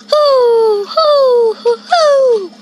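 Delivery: high, loud falling hoots; owl hoot. Shown as a demonstration. Listing animal sounds, owl hoots. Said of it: "Uh, owl".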